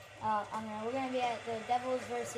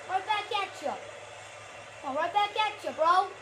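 high, loud trash talk; triumphant taunt; 6 semitones higher, 5 dB louder than usual. Real, not acted. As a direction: throw it back at your rival. Taunting opponent after a play.

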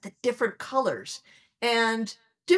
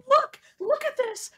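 much higher, thrilled call; excited showing. Retold or imitated. Scientist holding her cat up to the image, thrilled. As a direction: gush to the cat about the picture. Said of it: "so I scooped my cat up".

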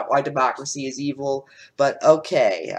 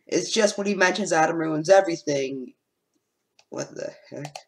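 ordinary delivery

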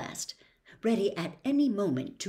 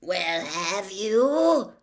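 slow, raspy, louder demand; raspy insisting; 5 dB louder, much slower than usual. A narrator's reading. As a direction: press the question in a croaking rasp. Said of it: "insisted the voice".

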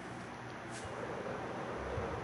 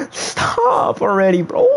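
mock whining; 13 semitones higher, slower than usual. Dead controller, mock crying.

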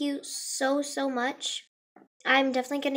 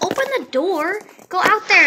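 raised-voice mock order; imitated urging sister; 4 semitones higher, 7 dB louder than usual. Sister telling her to get the package, mock urging. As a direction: lightly imitate a pushy sister.

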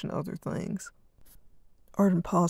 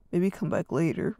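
usual speaking voice

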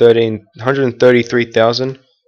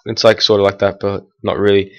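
ordinary talk

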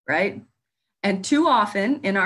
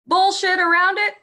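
jumps much higher and louder on the blunt word; emphatic outburst; 12 semitones higher, 6 dB louder than usual. Real, not acted. Speaker to panel, bursting out much higher and louder. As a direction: let the swear burst out, half laughing.

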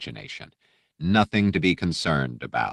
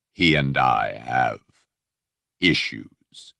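low, slow, introspective menace; musing darkly to himself; contemplative Vader; 5 semitones lower, much slower than usual. Acted. Vader musing on his enemy, slow and contemplative. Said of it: "The honeyed tones became contemplative, almost introspective".

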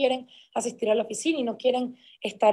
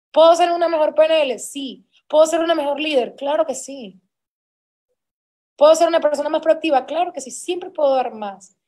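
loud rhetorical questions; 4 semitones higher, 6 dB louder than usual. Real, not acted. Rhetorical self-questions to the team.

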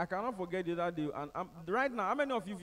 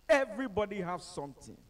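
ordinary delivery

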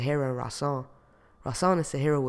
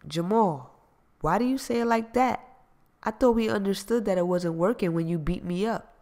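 higher indignant woman voice; protest, wide-eyed; lightly voiced Gina; 6 semitones higher than usual. Gina pushes back at Jamal on the phone, indignant.

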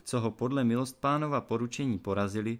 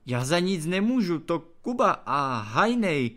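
high, loud protest; whiny excuse; 7 semitones higher, 6 dB louder than usual. A narrator's reading. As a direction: shift the blame in panic. Trautenberg shifts blame in panic.

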